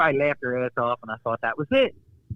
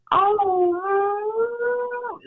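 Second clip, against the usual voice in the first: long, very high held "oh"; sustained falsetto cry of pain, acted out; loud high-pitched howl; 3 dB louder than usual. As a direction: wail it out at the top of your range. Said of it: "as loud as I could, man, at the highest pitched sound".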